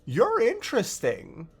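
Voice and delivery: a hint of a menacing god king; lightly voiced, grabbing hold of a prize; possessive Oryx. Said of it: "and pulls it back through and is like".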